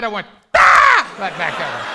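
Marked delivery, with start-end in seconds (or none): 0.6-1.1 high, very loud scream of fright; frightened scream. Retold or imitated. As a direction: scream right back in shock. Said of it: "Scared me so bad".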